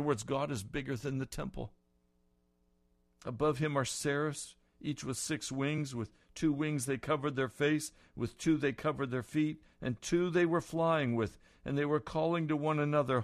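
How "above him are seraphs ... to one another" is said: loud, high, rising sermon voice; fervent preaching. Real, not acted. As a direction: build the vision with fire. Preaching at full force.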